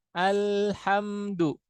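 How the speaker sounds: very slow Alhamdu, lam held; slow recitation. Shown as a demonstration. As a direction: stretch the lam in Alhamdu gently. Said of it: "ini tawasud ya". (Alhamdu dibaca sangat pelan, lam ditahan sedang)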